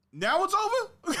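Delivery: very high, loud, drawn-out shocked question; outraged disbelief. Retold or imitated. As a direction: replay your shocked outburst at full pitch. Acting out shock at movie ending.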